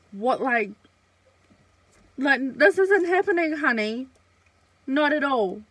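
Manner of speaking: loud, high, fiery refusal; indignant outburst. Retold or imitated. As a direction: tell him off, loud and incredulous. Confronting him, drunk and upset.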